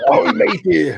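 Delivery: hammy pirate growl. Pretend. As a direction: play a cartoon pirate for a laugh. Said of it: "pirate impression".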